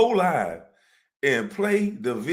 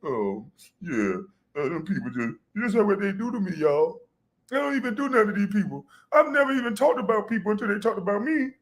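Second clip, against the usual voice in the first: mock victim whine; 5 semitones higher than usual. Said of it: "Then he'll get up there".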